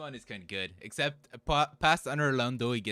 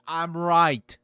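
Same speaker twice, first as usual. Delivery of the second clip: loud insisting; 4 semitones higher, 13 dB louder than usual. Acted. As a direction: blurt it out much louder, half joking. Insisting loudly he is right.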